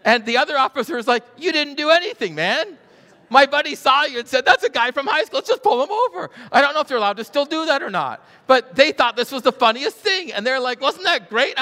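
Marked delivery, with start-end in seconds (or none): 1.4-6.3 high, loud, laughing retelling; laughing officer. Retold or imitated. Officer buddy explaining the prank pull-over, laughing. 10.8-11.6 loud, high gleeful shout; gleeful gloat. Retold or imitated. Officers delighted with their joke on the driver.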